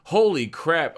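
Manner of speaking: loud high burst of surprise; surprised exclaim. Real, not acted. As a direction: blurt it out, caught off guard. Sees chart flash blue.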